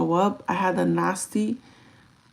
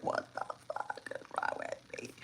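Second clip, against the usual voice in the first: hushed despair; despairing whisper; 16 dB quieter than usual. Retold or imitated. Quoting herself in stomach pain, whisper. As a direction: whisper it, drained.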